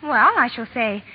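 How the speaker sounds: ordinary talk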